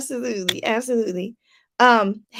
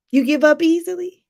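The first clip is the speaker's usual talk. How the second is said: ordinary talk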